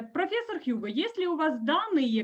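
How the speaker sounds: normal talking voice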